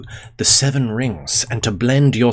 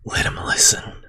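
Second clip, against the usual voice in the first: whisper; 3 dB quieter than usual. Real, not acted. Whispered reply to chat.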